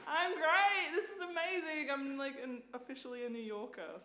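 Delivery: high, loud, laughing with joy; elated, laughing. Retold or imitated. Woman celebrating after subway confrontation, laughing and elated. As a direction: burst out triumphant. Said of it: "I was like".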